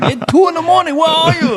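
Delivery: put-on high Thai-accented scolding; angry wife's accent. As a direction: imitate her Thai accent demanding answers. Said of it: "Now she's pissed".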